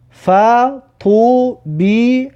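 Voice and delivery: slow, pausing after each syllable; syllable-by-syllable reading. Shown as a demonstration. Teacher has learners look at each letter, slowly splitting the word. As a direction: break "فطوبیع" into separate held syllables. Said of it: "هر حرف را نگاه کنید".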